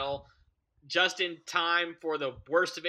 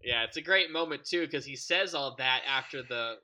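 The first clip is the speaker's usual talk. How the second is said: ordinary delivery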